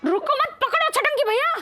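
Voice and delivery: calling out in a chase. Acted. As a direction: shout after him to grab him.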